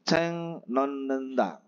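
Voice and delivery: slow, clear Korean phrase for learners; slow drilled ceng non nenda; slow model reading. Shown as a demonstration. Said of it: "Bacim T ketemu dengan N dibaca N".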